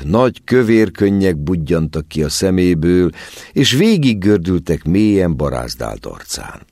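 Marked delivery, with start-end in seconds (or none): none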